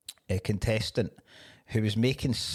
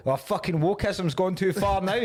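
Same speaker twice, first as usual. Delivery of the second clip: higher, hammed-up indignant moaning; mock outraged bloke; 9 semitones higher than usual. Mocking anti-woke complainers, a higher, hammed-up outrage. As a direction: lampoon the outraged traditionalist. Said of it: "this is where they go".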